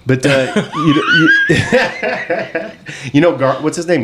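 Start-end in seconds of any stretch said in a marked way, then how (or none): 1.6-3.1 high-pitched burst of laughter; cracking up in a high laugh. Real, not acted. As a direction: break into a squeaky laugh. Laughing fit.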